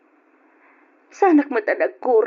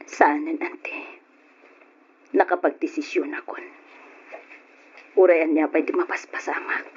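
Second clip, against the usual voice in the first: low, soft, half-whispered; telling her aunt her decision in a low whisper; hushed; 9 semitones lower, 5 dB quieter than usual. Acted. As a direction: confide it in a bitter hush. Hushed bitter confession to aunt.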